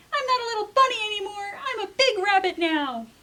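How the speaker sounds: put-on high, fast, whining little-kid voice; whiny kid. Jasper protesting to Mom, high whiny kid voice. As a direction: whimper it like a kid near tears.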